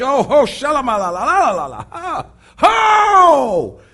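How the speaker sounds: loud, sing-song church shouting; hammed-up holy excitement, la la la; mock ecstatic worship. Preacher imitating himself worshiping in church, loud and ecstatic.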